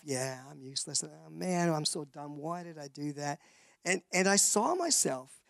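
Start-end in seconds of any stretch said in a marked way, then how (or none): 0.0-3.4 soft, dejected muttering to himself; a hint of self-defeat; quiet self-talk. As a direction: say it like you believe it. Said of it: "I just caught myself".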